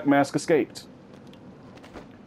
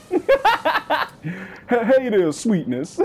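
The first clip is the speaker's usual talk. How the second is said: grandma greeting; 9 semitones higher, much slower than usual. Retold or imitated. Grandma greeting her grandkids, high sweet coo. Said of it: "She'll be like".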